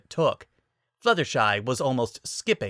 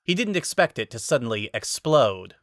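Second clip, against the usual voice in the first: ordinary delivery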